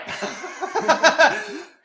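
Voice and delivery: big burst of laughter; loud laughter. Real, not acted. Guest laughing hard at his own story.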